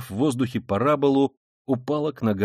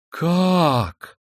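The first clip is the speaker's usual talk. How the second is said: higher cry of outraged disbelief; sudden cry; 4 semitones higher, 3 dB louder than usual. A narrator's reading. Katerina Ivanovna suddenly grasping the accusation, startled cry. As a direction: cry out as if just coming to. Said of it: "вскрикнула вдруг, опомнившись, Катерина Ивановна".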